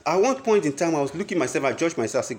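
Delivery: ordinary talk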